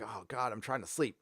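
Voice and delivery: soft, low grumble; sleepy complaint. Retold or imitated. Vlogger woken by a yelling livestream, soft weary grumble. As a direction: grumble quietly, half asleep. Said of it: "I'm like".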